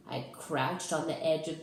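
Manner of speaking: ordinary delivery, nothing marked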